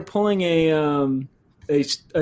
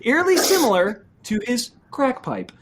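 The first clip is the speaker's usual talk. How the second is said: mock newscaster; 8 semitones higher than usual. Continues the mock news bit.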